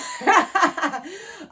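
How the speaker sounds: loud, high giggling laugh; real high laugh; high burst of laughter. Real, not acted. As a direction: crack up at the card. Laughs at the reading.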